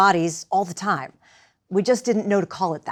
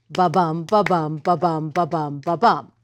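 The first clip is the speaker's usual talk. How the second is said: even "ba-bum" pulse, BUM stressed each time; steady heartbeat "ba-bum", landing on the second syllable; iambic rhythm; 3 semitones lower than usual. Shown as a demonstration. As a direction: chant "ba-bum" in fives, weak BA, strong BUM. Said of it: "iambic rhythm together".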